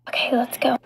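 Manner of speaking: ordinary delivery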